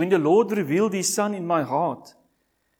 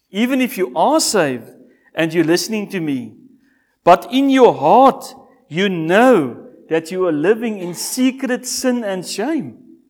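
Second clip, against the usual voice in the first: full-force preaching; 11 dB louder than usual. Acted. Preacher confronting Christians living in secret sin, loud. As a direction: thunder it at the hidden sinner.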